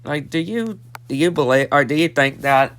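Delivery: ordinary talk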